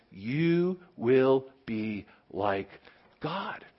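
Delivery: slow, hushed serpent whisper, fading to breath; the serpent whispering to Eve, slow and hushed; tempting whisper. Acted. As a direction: lure her in slowly, barely voiced. Serpent's promise repeated.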